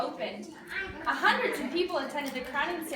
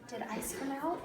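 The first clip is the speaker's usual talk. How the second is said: usual speaking voice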